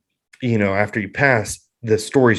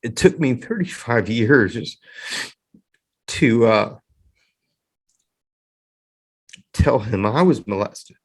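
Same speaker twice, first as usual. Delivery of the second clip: halting, tearful confession; fresh grief; 2 semitones higher than usual. Real, not acted. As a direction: push each word out through grief. Tearful confession after long pauses.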